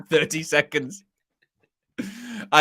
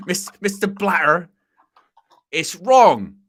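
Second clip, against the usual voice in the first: louder Bristolian protest; Bristolian Ian Holloway; 3 semitones higher, 5 dB louder, slower than usual. Retold or imitated. Pundit imitating Holloway, Bristolian accent.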